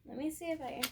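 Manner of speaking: ordinary delivery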